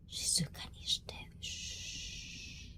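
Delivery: very soft whispered hush; ささやき声で. Real, not acted. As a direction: hush everyone gently. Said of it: "we have to not shout anymore".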